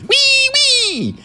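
loud, very high squealed "wee, wee"; piercingly high toy-ambulance sound; vehicle noise. A narrator's reading. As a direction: squeal like a tiny siren. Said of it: "He made the sound".

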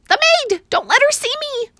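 very high, loud alarm; panicked. A narrator's reading. Mouse begging the boy to hide him, panicked. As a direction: hide me now. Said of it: "cried Ralph".

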